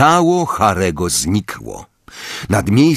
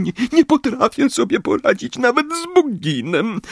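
high, tearful, embarrassed; shaky apology to Harry; trembling, much higher. Acted. Molly keeps weeping.

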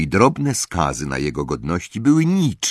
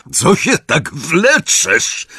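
high, loud, slow gruff uncle voice; angry roar; 10 semitones higher, 6 dB louder, much slower than usual. A narrator's reading. Uncle roaring at Harry to hurry up. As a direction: bellow in irritation. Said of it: "ryknął Vernon Dursley".

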